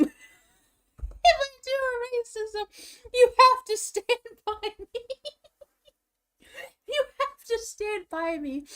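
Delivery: pleading way up high through laughter; giggly high-pitched plea; high laughing plea. Pretend. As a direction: squeak it out like a begging kid. Mock plea to co-host.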